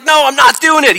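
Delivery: high, loud, stubborn refusal; fighting yell. Retold or imitated. John refusing in the fight, yelling. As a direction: shout back in a heated fight. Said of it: "I just told you they were fighting".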